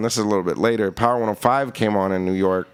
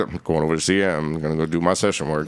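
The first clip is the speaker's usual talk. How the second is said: low, laid-back imitation of a singer; 7 semitones lower, faster than usual. Retelling the singer announcing his session work, low imitated voice. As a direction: drop the voice well down for a casual quote. [name] retold in low voice.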